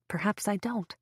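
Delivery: usual speaking voice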